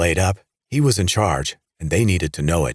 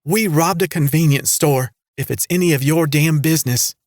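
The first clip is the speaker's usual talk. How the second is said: high, loud and fed up; annoyed; 10 semitones higher, 4 dB louder than usual. A narrator's reading. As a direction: snap the confession out, irritated. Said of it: "looking as annoyed as Vance".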